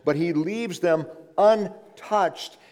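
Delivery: "untouched" drawn out and punched, "un" set apart; slow down and hammer "untouched" in two beats; stress on untouched. Real, not acted. Preacher returns to his point for congregation, stressing "untouched". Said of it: "I emphasize the word untouched".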